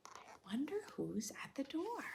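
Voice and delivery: soft suspenseful whisper; hushed curious whisper. Real, not acted. Suspense about the visitor.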